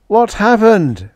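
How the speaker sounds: high, loud, drawn-out plea; desperate pleading. A narrator's reading. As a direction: beg for an answer, slowly. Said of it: "Collins pleaded".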